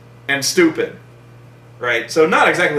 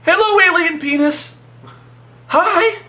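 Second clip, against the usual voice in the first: high cooing to a pet; hammed-up doting baby voice; baby talk; 10 semitones higher than usual. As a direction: coo at it like a puppy. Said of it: "He's like".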